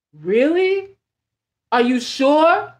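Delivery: imitating a let-down, doubtful questioner; slow, drawn-out questions with a rising lilt; mock incredulous letdown; disappointed disbelief. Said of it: "they're disappointed".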